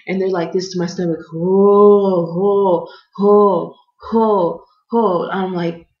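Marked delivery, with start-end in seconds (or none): 1.3-5.3 loud, repeated "ho"; booming mock exertion calls; imitated pushing noise. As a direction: mimic rhythmic heaving with loud "ho"s. Mimicking staff pushing on stomach.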